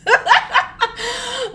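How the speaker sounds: high-pitched cackling laugh; real burst of high laughter. Real, not acted. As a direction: crack up in a high giggle. Cracks up after her shout.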